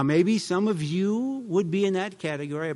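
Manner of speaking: ordinary talk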